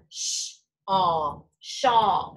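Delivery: "sh", "aw", "shawl" sounded out, then blended; blending sounds. Shown as a demonstration. Teacher blends the word in parts for the class. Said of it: "sound-by-sound blending".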